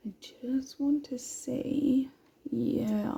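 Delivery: soft whisper to herself. Real, not acted. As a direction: breathe it out in a whisper.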